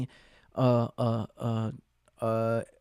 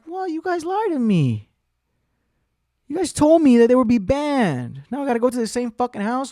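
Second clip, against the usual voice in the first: high-pitched acted protest; aggrieved complaint; 8 semitones higher, 4 dB louder than usual. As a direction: whine that you were betrayed. Complains to Amazon about lie.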